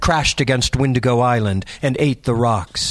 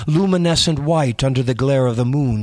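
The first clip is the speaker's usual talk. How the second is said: ordinary delivery, nothing marked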